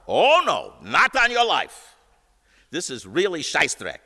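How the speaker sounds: high, loud, indignant Szell; indignant refusal. Retold or imitated. Szell flatly refusing the request.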